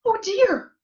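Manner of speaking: high, loud little squeal of dismay; wee little Baby Bear voice. A narrator's reading. Baby Bear sees his chair, wee little voice. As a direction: squeak it as a tiny upset cub. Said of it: "in his wee little voice".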